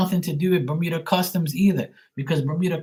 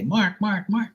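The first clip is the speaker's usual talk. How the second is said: higher cawing Mark, Mark; crow saying Mark; 6 semitones higher, slower than usual. Retold or imitated. Telling viewers how crows sound, cawing the name. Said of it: "They sound like they say".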